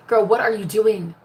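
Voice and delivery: ordinary talk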